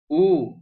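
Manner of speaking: U high and loud with pouted lips; rounded vowel. Shown as a demonstration. Teacher showing the dommah vowel, fully articulated. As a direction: push the lips forward on U. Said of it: "mulutnya harus monyong".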